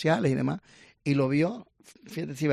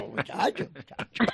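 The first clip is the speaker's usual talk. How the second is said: laughing muchacho; 3 semitones higher, slower than usual. Retold or imitated.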